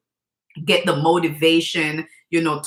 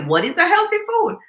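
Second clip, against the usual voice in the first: high, mock-innocent question; aunt pretending to ask her niece; playing dumb; 11 semitones higher than usual. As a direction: quiz the kid like you don't know. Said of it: "So I'm like".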